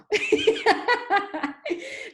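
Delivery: high, breathy self-conscious laugh; sheepish giggle; embarrassed laugh. Real, not acted. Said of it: "my energy is coming down now".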